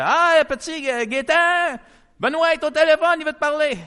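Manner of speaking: a playful mock of Patty calling him to the phone; wife calling out. Patty calls Gaétan to the phone, high sing-song call.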